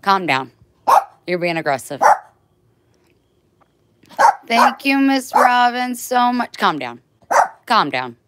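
loud, stern "calm down"; firm loud command. Real, not acted. Owner telling her overexcited dog to calm down, loud.

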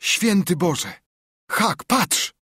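high excited cry of discovery; astonished boy calling out high. Acted. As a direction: cry out at the find, pitched high. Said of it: "Tomek zawołał".

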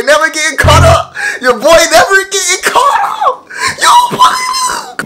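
high, excited half-shouted brag; hyped boast. Pretend. Bragging to strangers on the video chat, excited shout. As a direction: hype yourself up, shouting high.

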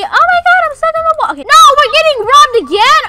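startled yelling. Real, not acted. Cashier to drive-thru customer, panicked high yell. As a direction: voice jumps high and loud in alarm. Cashier sees robbery mid-order.

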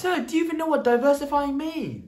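high-pitched, incredulous challenge; challenging. Acted. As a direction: challenge them in a high put-on voice. Challenging the other character.

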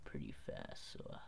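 put-on low voice; deep voice. Real, not acted. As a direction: drop the voice way down. Deliberately lowered voice after crack.

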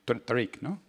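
ordinary delivery

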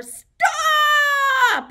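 long, high, loud shout of "stop"; playful holler. Pretend. Host halts children hopping round the room, playful holler. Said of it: "until I holler".

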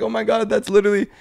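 high, excited disbelief; amazed retelling. Retold or imitated. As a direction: blurt it high, as if dying laughing. Retells laughing amazement at the girl.

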